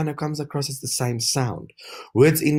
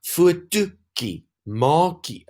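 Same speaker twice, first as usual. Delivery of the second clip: "Fotootjie, maakie" slowly sounded, higher, vowel before the ending stressed; emphasized vowel; 7 semitones higher, much slower than usual. Shown as a demonstration. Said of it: "because of the emphasized vowel".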